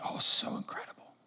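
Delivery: hushed, mock-savouring whisper; imitated whispery relish. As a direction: whisper it as if blissed out by food. Wife gushes over her toast.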